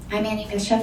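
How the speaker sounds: usual speaking voice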